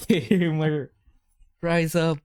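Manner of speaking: high-pitched playful gamer cheer; mock gamer hype. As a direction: ham up a rallying cry, laughing through it. Mock hype for gaming topic.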